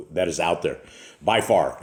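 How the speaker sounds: ordinary talk, nothing marked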